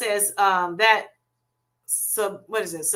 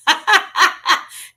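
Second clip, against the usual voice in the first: high, loud cackling laugh; hearty laugh; 8 semitones higher, 7 dB louder than usual. Real, not acted. Host amused over a pronunciation, laughing loudly. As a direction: burst out laughing in bright bursts.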